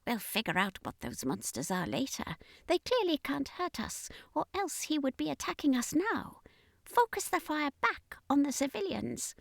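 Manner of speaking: high, soft alien voice giving cold orders; high hushed alien order; alien commander ordering. Acted. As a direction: command the gunners coolly. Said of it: "the commander ordered".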